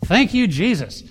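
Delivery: a happy exclaimed thank-you; relieved praise. Retold or imitated. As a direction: blurt out relief to God. Relief each time he came down.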